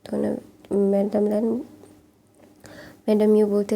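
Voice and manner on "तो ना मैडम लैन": usual speaking voice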